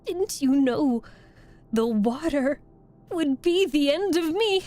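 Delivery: high, loud witch wail, rising at the end; wailing, despairing. A narrator's reading. Witch asking the girl as she melts, wailing. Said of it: "asked the witch in a wailing, despairing voice".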